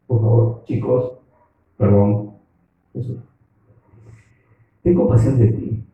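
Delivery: a lightly voiced Peter, pleading politely; wheedling Peter. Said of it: "Y por eso él dice".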